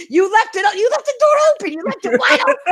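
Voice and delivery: high squeals of laughter through the words; can barely get the words out for laughing; real helpless laughter; laughing hard. Real, not acted. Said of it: "I'm laughing so hard".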